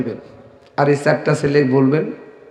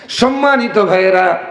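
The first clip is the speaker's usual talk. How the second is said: normal talking voice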